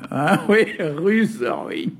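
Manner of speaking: laughing through the words; amused laughing. Real, not acted. Lecturer agreeing with an amused listener, laughing. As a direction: agree with a chuckle, repeating the word.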